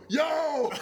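high yelled Yo; shouting at possum. Retold or imitated. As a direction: call out loud to scare it off.

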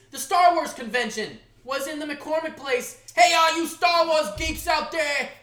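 loud, high, hammed-up hollering; boisterous mock-superfan shout; hollered shout-out. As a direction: call out to a crowd of fans.

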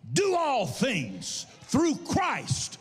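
high, slow, sung-out preaching; preaching at full force. Acted. Pastor preaching victory to the congregation, fervent. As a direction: lift the church with rising fervor.

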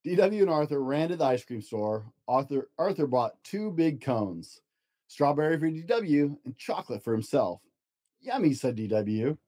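storybook narration, gentle and lively; reading to children. Acted. Dad reads picture book to child, storybook voice. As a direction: read it aloud to a child at bedtime.

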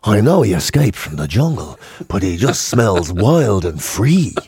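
drawn-out, low mock-seductive ad voice; deep lazy voice of a fantasy guy who smells wild; deep wild jungle voice. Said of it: "they'd be there going".

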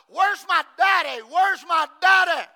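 put-on loud preacher's re-enactment of a girl's cry; acted screaming plea. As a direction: shout it out over a crowd. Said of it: "she's screaming out amongst that crowd".

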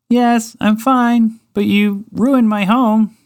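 high, slow little mouse voice. Acted. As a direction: speak as a small put-out mouse.

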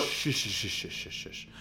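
fading, whispered shush. Pretend. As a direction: hush him down to silence. Hushing the cohost.

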